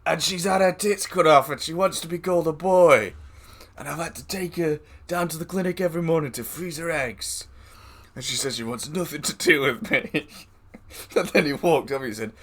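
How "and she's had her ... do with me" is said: imitating the guy. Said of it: "He's like".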